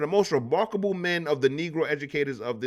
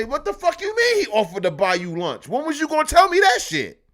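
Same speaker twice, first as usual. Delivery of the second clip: loud, high, outraged questioning; acted angry husband; 12 semitones higher, 6 dB louder than usual. Husband hears of the lunch offer, loud acted outrage. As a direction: blow up at your wife, rising to a peak.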